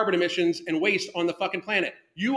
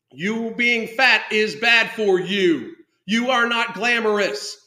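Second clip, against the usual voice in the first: slow, deliberate pronouncement; slow, emphatic declaration; 4 dB louder, slower than usual. Retold or imitated. Ranting host declaring the blunt truth, slow and emphatic. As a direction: spell out a hard truth word by word.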